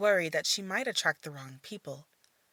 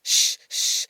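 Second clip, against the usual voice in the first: put-on shushing a child; 7 dB louder than usual. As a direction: hush the boy quickly. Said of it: "tries to calm the boy down".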